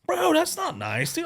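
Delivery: high, loud, playful "that's not cool"; mock hurt scolding. To the angry driver, playful hurt scolding. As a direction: tease him like a wounded friend.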